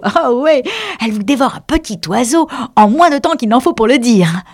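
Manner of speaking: high, loud enthusiastic praise; keen, animated Alice; eager. A narrator's reading. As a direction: gush proudly about a favourite pet. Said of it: "répondit avec empressement".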